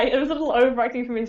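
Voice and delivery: ordinary delivery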